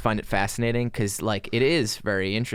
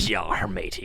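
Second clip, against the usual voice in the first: gruff playful pirate growl; 4 semitones lower, slower than usual. Pretend. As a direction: growl it like an old sea dog. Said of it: "do you have a pirate voice?".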